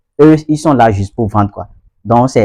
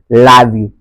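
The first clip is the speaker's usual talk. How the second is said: LOVE as "lahv" in a Ghanaian accent. Shown as a demonstration. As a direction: open "ah" in love, Ghanaian style. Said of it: "au niveau de la prononciation".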